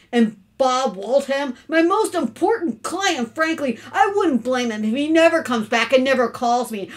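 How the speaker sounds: loud, indignant complaint; exasperated rant. Acted. Father complaining to his wife about their son, loud and exasperated. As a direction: rail at the boy's behaviour.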